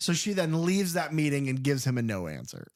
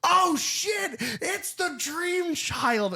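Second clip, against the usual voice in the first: freaked-out shout; much slower than usual. Retold or imitated. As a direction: blurt it out in alarm. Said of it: "I freak out at the party".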